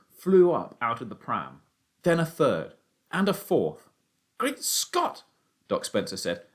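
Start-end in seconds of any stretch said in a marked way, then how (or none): 4.4-5.2 astonished. Acted. Doctor astonished by pheasants.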